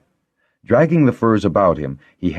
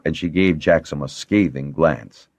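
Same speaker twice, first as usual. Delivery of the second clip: plain reading voice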